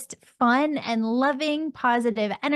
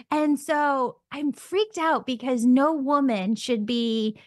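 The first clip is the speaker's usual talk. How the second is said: normal talking voice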